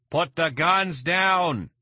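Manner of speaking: slow, very high, loud command; megaphone yell. A narrator's reading. Leon orders the kidnappers from the boat, slow megaphone yell. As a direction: bellow it through a megaphone from the boat. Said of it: "Leon yelling into a megaphone".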